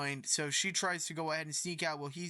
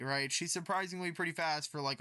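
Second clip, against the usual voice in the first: normal talking voice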